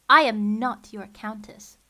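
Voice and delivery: slow, loud, extremely annoyed; testy. A narrator's reading. Violet correcting Olaf, testy and loud. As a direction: bite off each word. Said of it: "Violet said testily".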